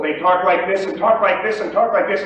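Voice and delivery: same talking voice, just turned up loud; rising decibel level. Shown as a demonstration. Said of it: "if they increase their decibel level".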